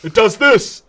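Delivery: put-on know-it-all shout; irate user voice. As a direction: correct them as an exasperated chat user. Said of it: "why does every user sound the same".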